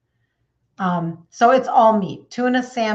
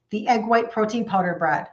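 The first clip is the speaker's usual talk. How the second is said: ordinary talk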